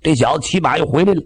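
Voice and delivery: plain reading voice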